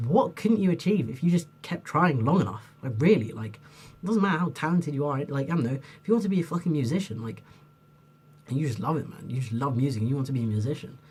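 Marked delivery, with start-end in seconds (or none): none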